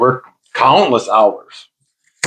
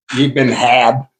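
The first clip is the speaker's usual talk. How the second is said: ordinary talk, nothing marked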